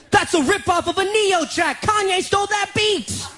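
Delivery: high, outraged accusation; imitated high-pitched rant; Darwish. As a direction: accuse in hammed-up indignation. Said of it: "He's like".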